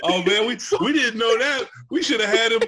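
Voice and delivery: loud, high-pitched laughing exclamation; excited outburst. Real, not acted. Co-host reacting to the guest's rap group past, excited. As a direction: burst out loudly, pitch way up, amused.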